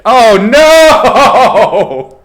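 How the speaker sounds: loud, high, drawn-out cry; big laughing howl of dismay; dismayed wail. Real, not acted. Dismay at the wheel's result.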